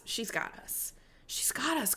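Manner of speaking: soft breathy whisper of reassurance. Real, not acted. Host whispering comfort to her past self, hushed.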